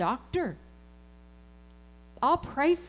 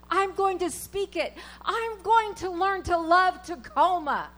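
forceful preaching; 5 semitones higher, 6 dB louder than usual. Acted. Preacher declaring faith to congregation, full-force preaching. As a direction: proclaim it loud and high, full of faith.

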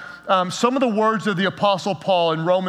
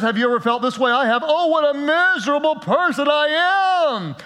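higher, rising to a shouted plea; despairing cry; 6 semitones higher than usual. Retold or imitated. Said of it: "He sounds like there is no hope".

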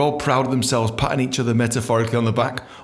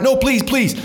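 pleading; 13 semitones higher, 3 dB louder, slower than usual. Retold or imitated.

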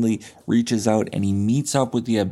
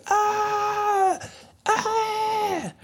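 very high, drawn-out acted scream; thin, high wail at ordinary volume; mock scream. Man buried alive screams in the box, mock terror. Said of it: "And he screams and he goes".

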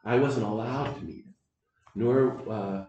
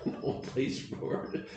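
laughing; 4 dB quieter, slower than usual. Real, not acted. Laughing aside after the punchline.

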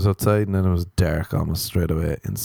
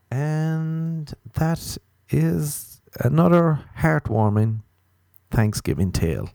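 slow storyteller voice; 7 semitones higher, slower than usual. Pretend. Mock sentimental story ending.

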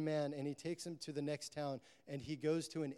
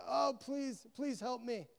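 much higher, pleading voice without shouting; crying out; 9 semitones higher, slower than usual. Retold or imitated. Suffering man begging the Levite, crying out. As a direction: act out a high, urgent plea. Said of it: "he starts crying out".

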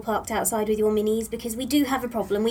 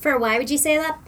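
put-on Irish accent; 3 semitones higher, 4 dB louder than usual. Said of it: "adopt Irish accents".

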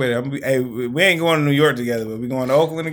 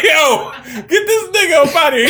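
high, loud comic call for help; mock alarmed shout; 13 semitones higher, 5 dB louder than usual. Acting out a New York mugging, hammed-up shout. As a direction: shout it high, laughing through it. Acted calling out in skit.

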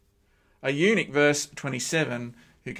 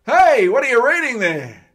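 high, loud hail across the road; calling out; 7 semitones higher, 12 dB louder than usual. Acted. Philip running beside the chariot, calling up loudly. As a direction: shout to catch his attention.

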